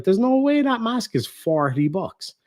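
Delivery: high-pitched, mildly hammed-up disbelief; retold disbelief. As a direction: go high, as if you can't believe it. Retells disbelief at mask ad.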